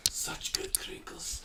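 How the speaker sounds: soft unvoiced whisper; ASMR whisper. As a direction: breathe it into the mic. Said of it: "we can all just whisper into it".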